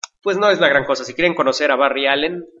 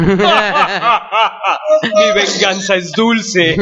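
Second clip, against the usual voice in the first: slow, gloating villain line; mad scientist; 3 semitones higher, much slower than usual. Pretend. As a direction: savour the revenge like a villain. Mock villain boasting revenge.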